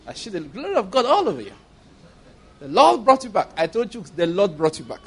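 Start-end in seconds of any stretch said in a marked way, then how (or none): 0.1-3.5 mock Christianese. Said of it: "begins to speak Christianese".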